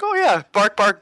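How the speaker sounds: high, loud mock-eager Joshua barking. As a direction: bark it out high like a proud kid. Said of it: "and he's like".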